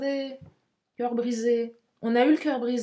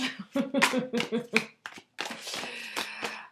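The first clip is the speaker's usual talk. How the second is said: breathy laugh; 3 semitones higher, 4 dB quieter than usual. Real, not acted. Laughing at her own pun.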